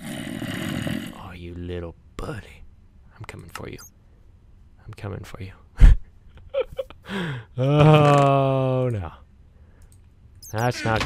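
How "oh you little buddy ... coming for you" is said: soft playful stalking threat; hushed mock menace. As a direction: creep up on the mob under your breath. Stalking a mob in game.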